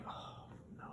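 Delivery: voiceless, soft sinking oh no; whispered dread. Retold or imitated. Dreading the next comment.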